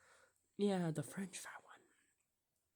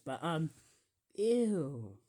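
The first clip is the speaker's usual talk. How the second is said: normal talking voice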